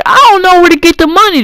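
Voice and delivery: fast, high-pitched whine of protest; mock protest. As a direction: blurt it out high and rushed, as an unspoken protest. Imagined panicked protest, never said aloud.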